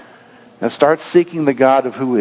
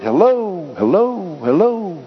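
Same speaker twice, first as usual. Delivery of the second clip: higher, fading echo of hello; echoing back; 5 semitones higher, slower than usual. A narrator's reading. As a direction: let each hello trail away like a mountain echo. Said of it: "back from the canyons and the boulders would reverberate".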